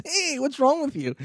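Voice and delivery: high put-on voice of an annoyed guy on the toilet; mock high-pitched protest from the stall; startled guy. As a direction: squeak it out like the interrupted stranger. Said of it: "and the guy goes".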